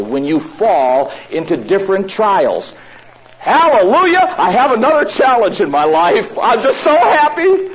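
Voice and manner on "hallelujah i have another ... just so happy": high, loud, gushing with laughter; hammed-up delight over a new challenge; mock overjoyed. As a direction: play it giddy and thrilled at trouble. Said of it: "Notice the word challenge".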